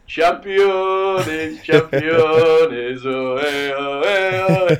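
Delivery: high, loud, drawn-out zombie chant; tongueless zombie voice. Retold or imitated. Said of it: "in their zombie voices with no tongues going".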